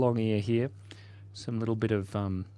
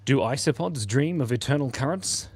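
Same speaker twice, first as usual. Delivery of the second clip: ordinary talk, nothing marked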